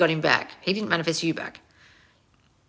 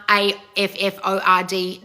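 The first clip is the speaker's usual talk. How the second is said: measured letter-by-letter spelling. Real, not acted. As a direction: space out each letter of A F F O R D. Spells out the avoided word again.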